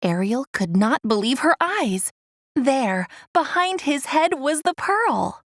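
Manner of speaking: plain reading voice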